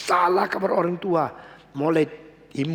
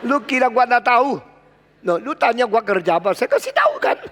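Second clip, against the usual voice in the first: put-on cheeky child; 6 semitones higher, 4 dB louder, faster than usual. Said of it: "Lalu anak bilang".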